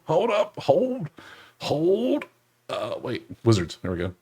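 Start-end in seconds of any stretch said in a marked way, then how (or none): 0.1-2.2 much higher, stretched hold calls; sudden hold up. Real, not acted. Catching something on screen, high sudden hold up. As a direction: stop yourself short, pitched way up. Stopping himself mid-search.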